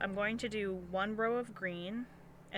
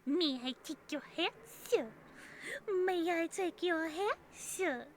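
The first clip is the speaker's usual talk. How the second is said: lightly put-on higher servant voice; a hint of a hammed-up butler; playful servant voice; 7 semitones higher than usual. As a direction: offer it like a mock-polite servant. Playful repeated role line, put-on voice.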